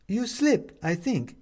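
high, slow, concerned woman's voice; worried neighbour woman. A narrator's reading. Upstairs neighbour finding him on the floor, worried.

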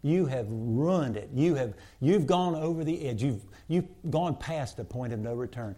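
ordinary talk

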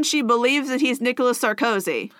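ordinary talk, nothing marked

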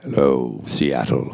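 very low, rich radio-host voice; Frasier. Acted. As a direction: drop deep and plummy like a sitcom shrink.

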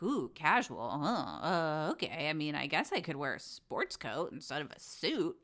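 low-pitched imitation of a let-down man; mock male voice, dropped low; awkward suitor impression. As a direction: play a clueless guy covering disappointment. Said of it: "And he's like".

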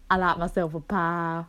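a hint of a Southern accent in a lazy mumble. Playing a guessing game, she keeps a faint Southern accent. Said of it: "I like this accent though".

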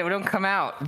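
lightly put-on southern accent; southern accent attempt. Shown as a demonstration. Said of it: "whenever I'm trying to do the accent".

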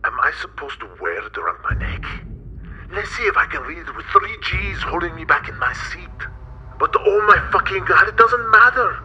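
rising panic, high and loud; panicking, voice climbing to a shriek; panicky. Acted. Raphael panicking about launch.

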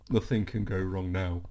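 slightly low, understated mimicry of Adam. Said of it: "my Adam voice is very similar".

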